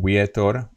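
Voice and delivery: vietor said as "wietor"; W-like start. Shown as a demonstration. Teacher exaggerates the sound, vietor with a W. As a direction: round the lips into a W to start vietor. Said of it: "sounds more like the W".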